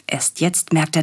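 ordinary delivery, nothing marked

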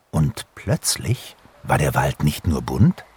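ordinary delivery, nothing marked

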